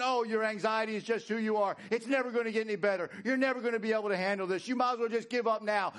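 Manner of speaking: fast, high, relentless taunts; shouting devil. Acted. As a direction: rattle off discouragement at high pitch. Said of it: "He's shouting".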